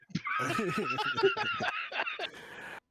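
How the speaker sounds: high shrill cackle; shrieking laugh. Acted. Character laugh, loud.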